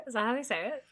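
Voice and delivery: ordinary talk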